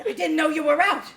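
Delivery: loud, defiant telling-off; standing up to her ex at last; angry. Acted.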